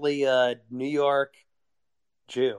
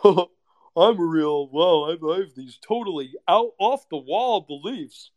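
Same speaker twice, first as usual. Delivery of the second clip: mock poser; 3 semitones higher, 4 dB louder than usual. Mocking poser again.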